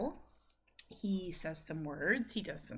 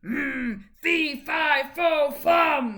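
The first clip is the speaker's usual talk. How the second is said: a hint of a stomping giant, higher and louder; mock angry giant; 6 semitones higher, 10 dB louder, much slower than usual. Mother playing a mock angry giant for her laughing son. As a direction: ham up the giant. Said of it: "Show me angry".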